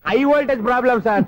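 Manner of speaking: high, emphatic blurting. Real, not acted. Electrician answers the annoyed customer, pitched high. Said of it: "ఆ మాట మెల్లగా కూడా చెప్పొచ్చయ్యా".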